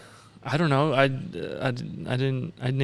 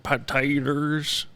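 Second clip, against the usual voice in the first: slow, a touch softer; Sling Blade impression; 5 dB quieter, much slower than usual. Cohost imitates the Sling Blade character, slow and soft. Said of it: "he talks like this".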